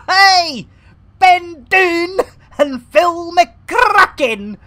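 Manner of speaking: hammed-up Australian accent shouted with a laugh; terrible Australian accent. Joking with chat about schoolyard names, in a bad Australian accent. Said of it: "apologies for the terrible Australian accent".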